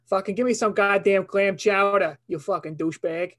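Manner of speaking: brash, cursing Boston accent; broad Boston swagger, dropped r's. Shown as a demonstration. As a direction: demand it like an irate Bostonian. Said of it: "I wanna hear your Boston".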